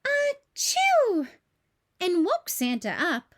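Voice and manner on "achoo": put-on a sudden high-pitched childlike achoo; high sneeze. A narrator's reading. Baby Bop sneezes in Santa's house, loud high sneeze. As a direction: sneeze it out big and high, like a little dinosaur. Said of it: "Baby Bop sneezed".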